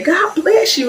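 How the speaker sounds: high, sugary church-lady blessing; sweet church lady. Retold or imitated. The wife greets the teller with a high sweet blessing.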